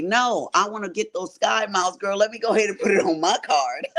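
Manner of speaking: playful country-accent mimicry. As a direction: imitate a country friend, breaking into a laugh. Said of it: "her little country accent".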